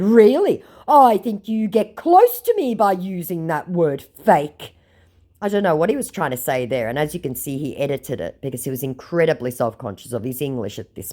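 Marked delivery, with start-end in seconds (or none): 0.0-4.7 slow, raised scammer voice; mocked scammer. Scammer objecting to being called fake, mocking voice. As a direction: laboriously mimic his broken English. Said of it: "he said".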